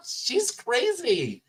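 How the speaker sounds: high, slow laughing words; stifled giggles. Real, not acted. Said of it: "I'm trying not to laugh".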